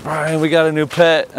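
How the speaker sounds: excited announcement. Retold or imitated. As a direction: burst in thrilled about the new pet. Friend bursts in with news.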